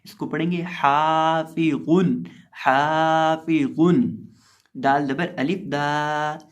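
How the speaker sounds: दाफिकिन with a long दा, spelled slowly; lengthened Da. Shown as a demonstration. Teacher drills pupils, slow spelled recitation. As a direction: stretch दा in दाफी and दाफिकिन. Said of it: "दाल को लंबा करके पढ़ेंगे".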